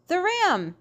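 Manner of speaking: high-pitched, mildly exclaimed yell; a storyteller's restrained yell. A narrator's reading. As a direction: call it out high without much volume. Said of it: "yelled Noah".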